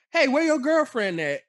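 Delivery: high, loud mock questioning; nosy relative's call. Man imitating family asking after his girlfriend, high and loud. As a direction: pipe up loudly, imitating a prying relative.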